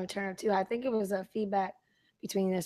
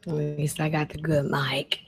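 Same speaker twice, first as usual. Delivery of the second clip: whispering; 4 semitones lower, 6 dB louder, slower than usual. Real, not acted. Whispered aside on stream.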